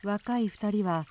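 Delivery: ordinary talk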